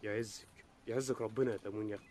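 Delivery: mock sweet blessing. Electricity card mocks the ration card.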